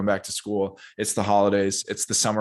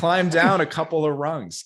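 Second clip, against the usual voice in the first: high, loud and drawn out; emphatic coaching; 8 semitones higher, 5 dB louder than usual. Acted. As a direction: urge them loudly, stretching the words. Exhorting the imagined quitter.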